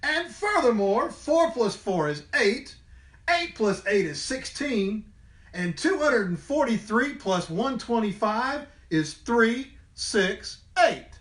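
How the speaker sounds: high, showy know-it-all voice; know-it-all capybara. Acted. Capybara showing off her sums to the teacher. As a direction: rattle off the sums to show off. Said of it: "shouted Camila Capybara".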